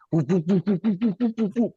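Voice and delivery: high, quick run of whoops; mimicked slipping whoops. Retelling a movie gag of slipping on blood, high mimicked whoops.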